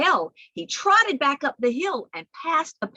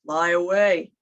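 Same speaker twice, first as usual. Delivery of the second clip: lower, rumbling call; 5 semitones lower, 5 dB louder than usual. Shown as a demonstration. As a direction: say it down low and big. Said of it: "one named Low".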